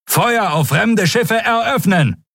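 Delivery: high, drawn-out battle order; calling out. A narrator's reading. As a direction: call the firing order across the ship. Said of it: "rief er".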